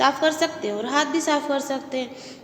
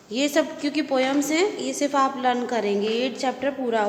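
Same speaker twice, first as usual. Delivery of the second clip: ordinary talk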